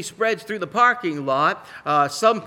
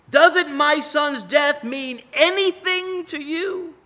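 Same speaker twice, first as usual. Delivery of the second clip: much higher, louder, slow indignant cry; outraged outburst; 11 semitones higher, 7 dB louder, slower than usual. Pretend. Grieving father confronting a careless crowd, loud indignant outcry. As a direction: jump up and demand they care. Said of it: "I would want to jump up and say".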